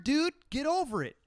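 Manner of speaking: ordinary talk, nothing marked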